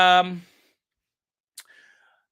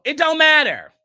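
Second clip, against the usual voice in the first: emphatic retort; 5 semitones higher, 8 dB louder than usual. Real, not acted. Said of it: "stop trying to put that emphasis".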